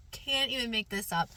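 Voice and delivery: normal talking voice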